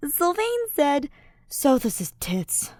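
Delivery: put-on slow, drawn-out drunk voice; slow tipsy confession; tipsy Ash. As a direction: admit it sheepishly, drunk.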